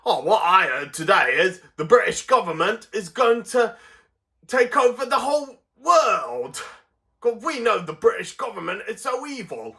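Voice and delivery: high, hammed-up Cockney gossip; Cockney accent. Cockney grumbling about the British government, high mock Cockney. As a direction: pass on scandal in an exaggerated Cockney voice. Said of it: "the Cockneys don't say governor".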